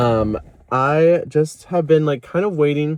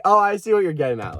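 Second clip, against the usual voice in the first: excited realization; 8 semitones higher than usual. Retold or imitated. Retold realization in therapy.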